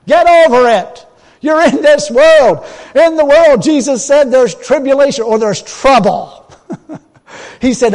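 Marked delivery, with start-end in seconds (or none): 0.1-6.5 loud, high preacher's shout; preaching at full force. Acted. Preacher telling his congregation to get over it, shouting.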